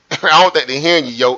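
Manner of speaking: ordinary talk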